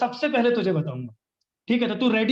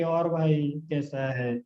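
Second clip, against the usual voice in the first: very slow, lower, softer, bored greeting; limp and lazy; 7 semitones lower, 5 dB quieter, much slower than usual. Shown as a demonstration. Coach showing how not to greet a prospect, sluggish and low. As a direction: drag out और भाई कैसा है like you couldn't care less. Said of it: "बहुत ढीले होके".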